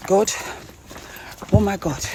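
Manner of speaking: ordinary talk, nothing marked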